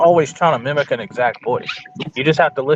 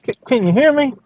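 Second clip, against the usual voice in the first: Ricky on the phone; 9 semitones higher than usual. Retold or imitated. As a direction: holler for Ricky down a bad line.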